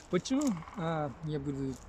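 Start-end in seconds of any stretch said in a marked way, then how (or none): 0.1-0.4 sudden high squeak of a question, half-joking; mock surprise. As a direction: play shocked at your own words. Mock surprise at own plan.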